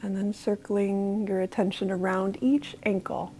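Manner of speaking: soft, unhurried body-scan voice with long pauses; guided meditation. Real, not acted. Leading listeners through a body scan from toes to legs, guided meditation. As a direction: gently guide them through the body, calm and quiet.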